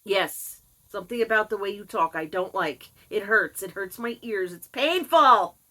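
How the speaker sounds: emphatic comeback building to a loud cry; exasperated retort. Retold or imitated. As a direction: answer back, exploding on the last words. Acted retort to mother's complaint.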